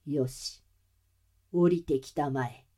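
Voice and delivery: low, quiet villain command; quiet menacing order. Acted.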